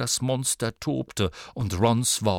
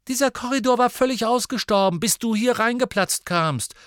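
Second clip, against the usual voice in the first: fast, much higher, louder comeback; angry retort; 12 semitones higher, 6 dB louder than usual. Acted. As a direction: snap back at him quickly. Sister snaps back at brother.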